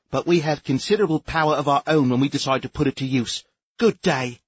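haughty bureaucrat lecturing the captain; officious inspector. A narrator's reading. Sneef warns the captain.

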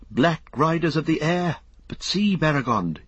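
high, urgent cry of alarm. A narrator's reading. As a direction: cry out to a companion, pitched well up. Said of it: "he cried".

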